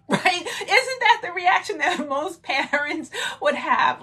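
talking through real laughter; high, loud, laughing words. Real, not acted. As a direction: laugh as you ask for agreement. Laughs at parents' likely reaction.

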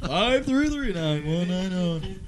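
number read through a laugh. Real, not acted. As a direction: giggle the digits out.

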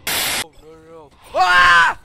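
scared screaming. Real, not acted. Chased in horror game.

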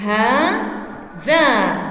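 هذا stretched very slowly into two long syllables; split pronunciation demo. Shown as a demonstration. Teacher showing prosodic writing, هذا drawn out syllable by syllable. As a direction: sound out هذا slowly, pausing between its syllables. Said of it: "نلفظ هذا".

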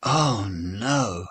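plain reading voice

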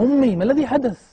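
high, loud, fast cry to his mother; panicked question. Retold or imitated. Son to fainting mother, asking what happened, panicked and loud. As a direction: son begs to know, voice high with alarm. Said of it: "حتى قلت لها".

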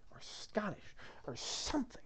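hushed whispered aside; whispering. Real, not acted. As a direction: drop to a whisper.

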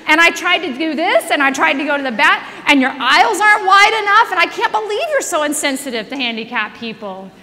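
hammed-up complaint rattled off; ranting. Friend rants at the airline office, loud mock rant. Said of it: "just, like, ranting".

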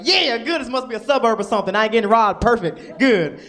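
loud, high relieved cheer; over-the-top relief; relieved excitement. Retold or imitated. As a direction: celebrate being safe out loud. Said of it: "It's like".

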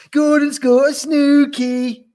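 high, loud, worked-up cry; babbling. A narrator's reading. Yak tells the gnu, babbling. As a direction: blurt the gossip wildly. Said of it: "babbled the yak".

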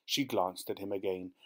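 ordinary delivery

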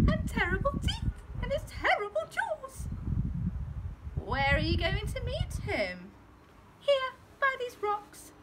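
high mouse voice spinning a scary tale; scheming mouse. Acted. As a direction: spook the fox with a high mouse voice. Mouse describes Gruffalo.

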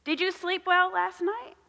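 ordinary talk

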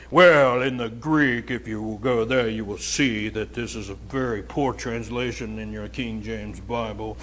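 a faint hint of a pompous scholar lecturing; mocking preacher. Mocks a preacher lecturing the church, lightly pompous. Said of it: "That's how they talk".

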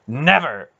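loud, emphatic refusal; intense. A narrator's reading. Aunt refusing to be finished with Garion, intense. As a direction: ring it out with total conviction. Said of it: "the intensity of Aunt Paul's words".

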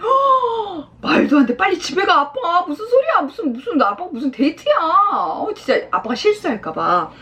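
acted daughter's alarmed outburst; indignant daughter. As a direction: scold dad in flustered disbelief. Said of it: "이렇게 한 거니까 이제 딸이".

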